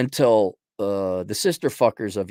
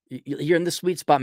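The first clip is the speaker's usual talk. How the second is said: usual speaking voice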